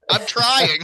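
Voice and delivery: high, loud, laughing mock yell; comic cry of protest; exasperated protest. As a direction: yell back helplessly, half laughing. Acted shout back to the bench.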